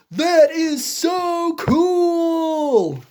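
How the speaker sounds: slow, drawn-out shout of amazement; thrilled wonder. Acted. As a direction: stretch every word out in awe. Elephant thrilled to be in book.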